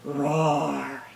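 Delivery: low, drawn-out dragon roar. Pretend. Teacher shows kids a dragon's roar, low and drawn out. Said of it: "Like a dragon does".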